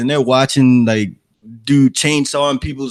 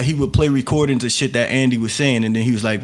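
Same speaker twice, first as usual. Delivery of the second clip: ordinary talk, nothing marked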